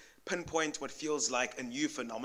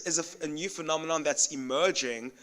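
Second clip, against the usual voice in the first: normal talking voice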